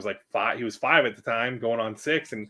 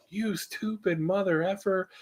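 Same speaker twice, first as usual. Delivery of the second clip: retold road rage, pitched up and slow; mock scream; 9 semitones higher, 4 dB quieter than usual. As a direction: stretch it out in a held-back mock scream. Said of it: "I screamed at the guy".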